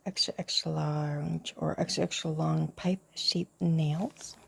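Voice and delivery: hushed show-and-tell whisper; whispered ASMR. Real, not acted. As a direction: breathe the words close to the mic. Whispered unboxing commentary.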